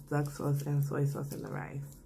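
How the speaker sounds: usual speaking voice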